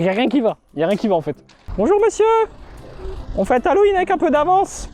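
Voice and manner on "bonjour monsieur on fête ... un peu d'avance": high falsetto nurse at the door. Acted. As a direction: greet them in a high, sugary falsetto. (voix de fausset d'infirmière)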